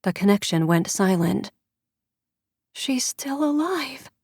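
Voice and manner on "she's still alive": slow, soft and high; whispered. A narrator's reading. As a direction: breathe it out slowly. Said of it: "Diana whispered".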